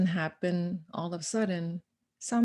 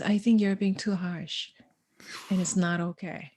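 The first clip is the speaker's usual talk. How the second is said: whispered quote. Retold or imitated. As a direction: breathe it out like a hushed aside. Quoting nephew, then whispering it.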